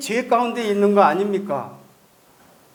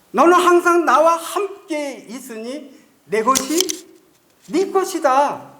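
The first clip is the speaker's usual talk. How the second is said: father's voice; 7 semitones higher, 3 dB louder, slower than usual. Acted.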